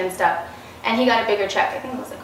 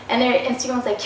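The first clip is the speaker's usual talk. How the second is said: ordinary delivery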